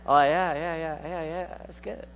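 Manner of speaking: mock glazed-smile agreement, fading to a murmur; empty polite yeah-yeah, trailing off quietly; polite fake enthusiasm. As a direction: brush them off with a hollow yes, yes. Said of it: "the person with the glazed smile".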